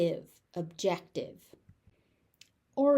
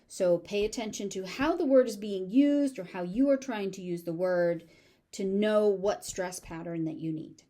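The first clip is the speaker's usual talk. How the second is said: normal talking voice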